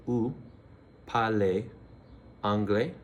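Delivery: "Vous", "parlez", "anglais" split apart, each rising; teacher breaking up "Vous parlez anglais?"; word by word, rising. Shown as a demonstration. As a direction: pause after each word, end "anglais" on a rise. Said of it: "a little inflection at the end".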